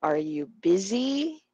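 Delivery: "busy" stretched with a rising tune; stress on BUSY. Shown as a demonstration. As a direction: lean on "busy", glide over "Are you". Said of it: "we say it louder".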